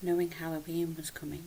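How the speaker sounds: ordinary delivery, nothing marked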